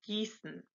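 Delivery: Gießen with long "ee" and a voiceless ss; double S demo. Shown as a demonstration. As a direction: softly model Gießen, no z sound. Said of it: "that's a double S".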